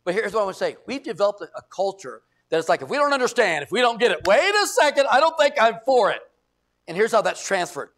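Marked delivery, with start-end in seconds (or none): 2.8-6.2 loud, high mock protest; hammed-up balking congregant; indignant objection. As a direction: push back hard like an affronted member. Mimics congregants challenging decisions.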